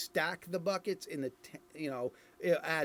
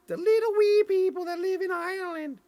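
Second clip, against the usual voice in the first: very high, loud squeaky little-people voice; tiny wee folk voice; 8 dB louder than usual. Pretend. Irish reviewer voicing the wee people, high and squeaky.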